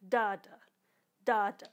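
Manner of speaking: DA-da, first DA punched loud, second da lighter; uneven drum bounce. Shown as a demonstration. Showing drummers a bounced second stroke, uneven DA-da with the first hit heavy. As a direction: mimic a bouncing stick, strong DA then weak da. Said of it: "it ends up being lighter than the first one".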